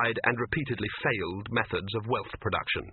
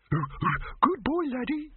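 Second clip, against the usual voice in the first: cooing voice; much slower than usual. A narrator's reading. Cooing at dog.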